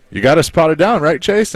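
high-pitched, tickled question; amused retold quote. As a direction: retell his teasing question on the edge of laughter. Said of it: "we're all laughing".

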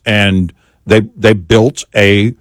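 normal talking voice

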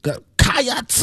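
"Cats" stretched up into a rising KAH-ats, pitch jump; clumsy try at "Cats" with an added syllable; mimicking Cates. Shown as a demonstration.

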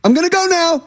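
very high-pitched, playful signalling voice; high mock announcement. Player signalling the corner kick, high mock announcement. Voicing the raised-hand signal.

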